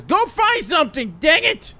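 high, loud frustrated yell; exasperated order. Acted. Stuck in the water, sends Bobby for help, exasperated. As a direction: yell the order at a useless helper.

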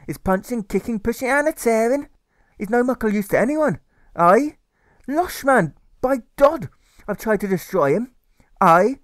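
a furious Scots carer defending himself; Scottish carer. A narrator's reading. The carer rants in a Scottish accent at Alfred.

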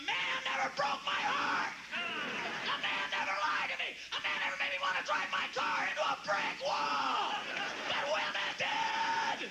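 high shouted grievance, slowing at the end; wounded yelling; bitter rant. Acted. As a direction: rail against women. Ranting about heartbreak.

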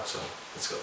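breathy whisper while sneaking in. Real, not acted. Sneaking into the washroom to hide, whispered. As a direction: whisper it so nobody hears.

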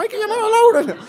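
high, laughing mock mother. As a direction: squeak the mum's catchphrase, laughing. Mother's phrase mocked. (imitando a la madre con voz aguda entre risas)

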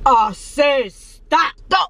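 slow man voice shout. Pretend. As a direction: bark it like a gruff man. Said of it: "a man voice".

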